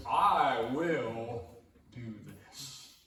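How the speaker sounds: slow, loud and emphatic; voicing God who will act; God's firm promise. Preacher booms God's promise to the congregation, slow and emphatic.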